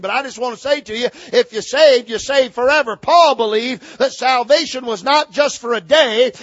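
high, driving pulpit voice, stretching the climax; full-force preaching. Real, not acted. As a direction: punch out the doctrine with rising fervor.

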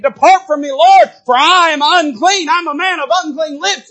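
high, loud pleading cry. Pretend. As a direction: cry out like Peter begging the Lord to leave. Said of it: "crying out like Peter did".

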